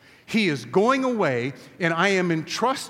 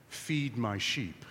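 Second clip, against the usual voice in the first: slow, softer, weighty; grave and quiet; 6 semitones lower, 8 dB quieter, slower than usual. Acted. Jesus answering Peter's declaration, slow and grave. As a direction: drop it down and let it land. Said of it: "Jesus says this".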